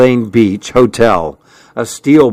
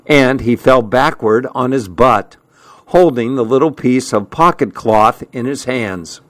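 ordinary delivery, nothing marked

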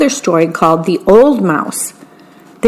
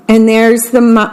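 plain reading voice

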